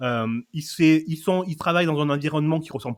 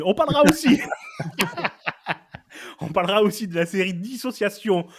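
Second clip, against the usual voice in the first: en riant; 6 semitones higher, 4 dB louder than usual. Real, not acted. Rit en annonçant.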